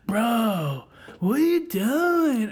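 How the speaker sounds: a hint of a dim stoner drawl; imitating a moron. Mocking the coworker who talked like a moron, slow dopey voice. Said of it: "he talked like a moron".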